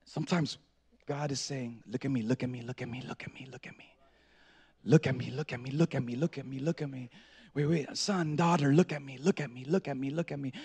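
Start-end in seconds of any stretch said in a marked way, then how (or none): 1.9-7.1 low, very soft repetition; near-whispered insistence, gently urging; hushed, tender plea. Acted. God calming his child. 7.5-10.5 low, soft, insistent; hushed acted reassurance, repeating steadily; tender fatherly plea. God calming son and daughter.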